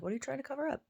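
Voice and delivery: mock the heavy makeup under her breath; incredulous aside. Judging a woman's pancake makeup, hushed incredulous question.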